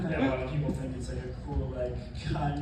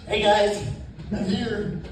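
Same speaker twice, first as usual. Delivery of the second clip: higher, louder, a mock timid nasal greeting; timid nasally voice; 6 semitones higher, 5 dB louder than usual. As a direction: play the shy nasal guy announcing himself, hammed-up. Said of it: "timid, quiet, kinda has, like, a nasally voice".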